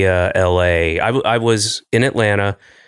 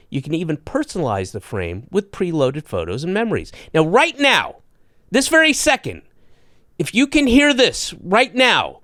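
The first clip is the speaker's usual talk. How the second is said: upbeat host ad read, pitched up; 5 semitones higher than usual. Acted. Podcast host reading sponsor ads, upbeat ad voice. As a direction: sell it like a podcast sponsor spot.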